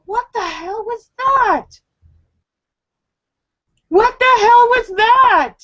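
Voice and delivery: high, exasperated outburst; retold outraged disbelief. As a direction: exclaim it in high-pitched disbelief. Retelling her shocked disbelief.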